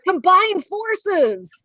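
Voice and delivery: high, very slow proclamation; playful drawn-out heroic announcement; mock rallying cry. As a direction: stretch it out like a hammy team-up call. Joking team-up interjection.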